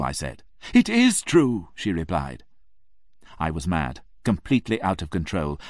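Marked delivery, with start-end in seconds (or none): 0.7-1.7 insistent lady. Acted.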